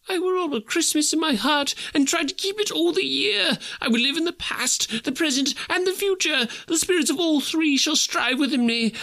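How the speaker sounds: high, desperate pleading; Scrooge in agony. A narrator's reading. Scrooge on his knees before the spirit, begging in agony. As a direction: beg to change the writing on the stone.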